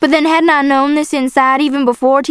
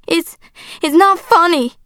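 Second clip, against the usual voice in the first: higher, halting objection; protest, stumbling on the start; hurt protest; 7 semitones higher than usual. A narrator's reading. Girl objecting to father's laugh, hurt protest.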